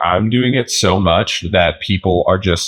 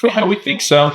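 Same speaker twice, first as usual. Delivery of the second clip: ordinary talk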